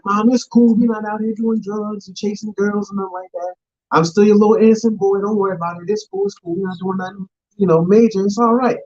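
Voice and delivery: high-pitched voice of a son reassuring Mom; pleading innocent son. Acted. Son telling his mother not to worry, high and pleading.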